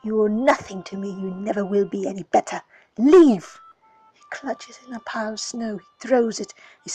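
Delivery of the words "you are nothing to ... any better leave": loud, fast angry tirade; shouting, faster in the middle; furious yelling. Acted. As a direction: rage at the child to be gone. Calion screams at the child.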